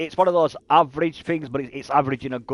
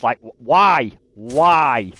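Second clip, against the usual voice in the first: exasperated why; 7 dB louder, much slower than usual. Retold or imitated. Exasperated at sardine pizza.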